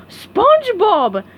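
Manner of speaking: high, loud, drawn-out shout of the name; startled Patrick bellows the name; yelled. A narrator's reading. Patrick woken by a crash yells at SpongeBob. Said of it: "he yelled".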